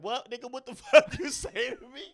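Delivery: high, loud, confrontational comeback; man turning on the kids who insulted him; angry retort. Retold or imitated. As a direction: challenge him back hard. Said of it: "He was like".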